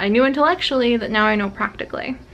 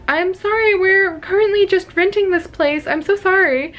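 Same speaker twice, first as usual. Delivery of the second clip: flustered apology; 8 semitones higher than usual. Retold or imitated.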